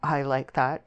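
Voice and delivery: usual speaking voice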